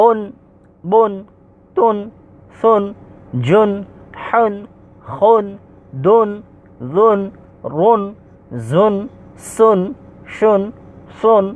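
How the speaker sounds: careful Arabic letter sounds, one measured syllable at a time; pronunciation drill. Shown as a demonstration. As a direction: recite each syllable separately, from উন to সুন, with a pause after each. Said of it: "আমরা পড়তেছি, লক্ষ্য করে আপনারা শুনুন". (উন থেকে সুন, থেমে থেমে স্পষ্ট উচ্চারণ)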